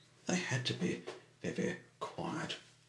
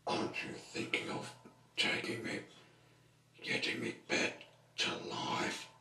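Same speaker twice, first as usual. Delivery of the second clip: voiceless, rasping plea; whispery character voice; 11 semitones lower than usual. Acted. Figure asks to be revived.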